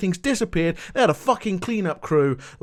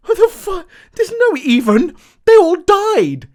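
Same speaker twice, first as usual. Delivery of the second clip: outraged outburst; 11 semitones higher, 8 dB louder than usual. Real, not acted. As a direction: explode at the nonsense. Ranting at the show's logic.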